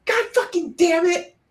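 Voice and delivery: put-on very high, tiny child voice; little girl's voice. Retelling the overheard younger daughter, tiny high child voice. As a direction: mimic a small kid swearing. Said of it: "It's so funny hearing that little voice".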